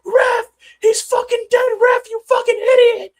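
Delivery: hysterical high-pitched screaming at the ref; shrieking outraged meltdown impression; MMA Guru. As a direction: scream it, completely losing your mind. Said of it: "losing his fucking mind".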